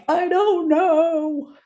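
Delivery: high, slow, whiny little voice; sulky inner self. Retold or imitated. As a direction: drag it out like a sulking child. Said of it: "two people".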